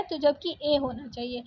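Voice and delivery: ordinary talk, nothing marked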